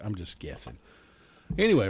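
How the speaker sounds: ordinary delivery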